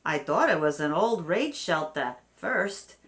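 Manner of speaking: a quick, lightly put-on New Yorker accent quoting the homeowner. Host quoting a homeowner in a New Yorker accent. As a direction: say it like a guy from New York. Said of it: "that's more of a New Yorker accent".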